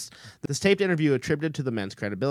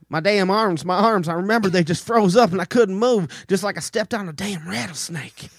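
put-on S0 reading the frightened abductee's transcript in a Southern accent; dramatic Southern accent; 4 semitones higher, 6 dB louder than usual. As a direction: act out the terrified witness in a thick Southern accent. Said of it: "this dramatic monologue there in a Southern accent".

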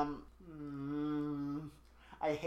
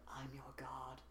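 breathy, much softer seductive whisper; 3 semitones lower, 17 dB quieter than usual. Retold or imitated. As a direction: purr it into his ear. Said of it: "she whispers really seductively".

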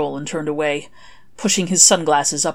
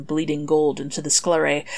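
ordinary delivery

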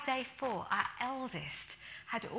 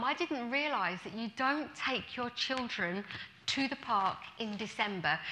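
normal talking voice

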